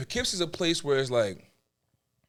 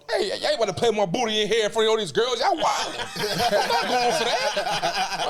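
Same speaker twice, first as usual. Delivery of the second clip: put-on refusing the exam in front of old classmates; laughing protest; 10 semitones higher, 5 dB louder than usual.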